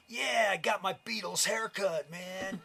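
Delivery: high-pitched, thick New York accent impression. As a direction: imitate an excited kid with a heavy New York accent. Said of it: "in his thick New York accent".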